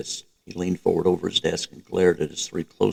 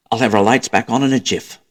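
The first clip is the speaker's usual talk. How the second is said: louder, brisk British cheer; cheery triumphant; 3 semitones higher, 6 dB louder than usual. A narrator's reading. Hawthorne reassuring the women about the lights, cheerily triumphant. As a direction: promise it breezily, pleased with himself. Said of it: "he said triumphantly".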